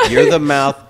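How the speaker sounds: ordinary delivery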